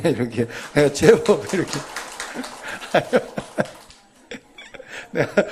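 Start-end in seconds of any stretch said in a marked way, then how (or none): 1.8-3.6 airy unvoiced laugh after the song; breathy laugh. Real, not acted. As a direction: chuckle at your own singing. (노래 후 멋쩍은 웃음)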